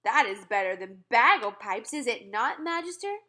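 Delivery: loud boasting giant; pleased with himself; gleeful giant. Acted. Giant boasting after the noise.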